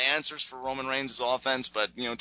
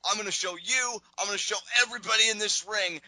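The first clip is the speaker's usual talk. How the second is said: mock furious heel promo; Seth snapping; 7 semitones higher than usual. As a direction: snap like a furious wrestler ranting. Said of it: "Seth snaps".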